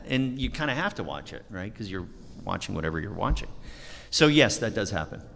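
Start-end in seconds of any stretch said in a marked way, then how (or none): none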